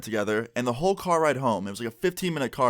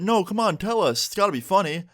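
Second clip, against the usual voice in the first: high-pitched imitation of a pestering grandpa; coaxing grandpa; 9 semitones higher than usual. Grandpa chimes in for the caption at dinner, coaxing. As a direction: coax him as the grandpa, pitched up.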